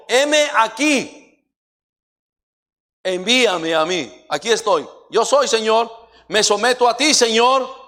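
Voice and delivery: very high, loud impassioned cries; shouted sermon climax; preaching at full force. Acted. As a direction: call out at the top of the voice to God and congregation.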